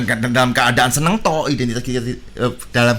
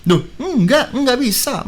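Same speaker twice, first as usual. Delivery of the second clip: put-on higher-pitched, mildly defiant kid; defiant refusal; 8 semitones higher, slower than usual. Boy answers his teasing sisters, lightly defiant. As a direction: imitate a child brushing off the teasing.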